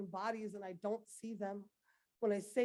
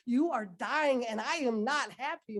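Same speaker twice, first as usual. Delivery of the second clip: louder, pained declaration; anguished outcry; 3 semitones higher, 8 dB louder than usual. Acted. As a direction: push it out at full force. Poem's climax to mother America.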